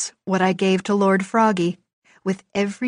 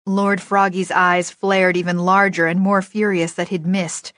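usual speaking voice

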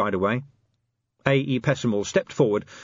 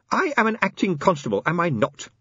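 high-pitched, formal little official; insistent inspector; 9 semitones higher than usual. Acted. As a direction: press the point in a high, formal voice. Inspector insists on his rank.

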